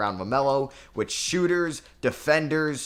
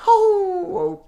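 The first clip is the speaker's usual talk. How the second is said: a sudden, much higher yelp of dismay; shocked cry; 10 dB louder than usual. Real, not acted. Gamer watching his team blow the lead, shocked cry. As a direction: cry out as the game turns. Playoff lead slipping away.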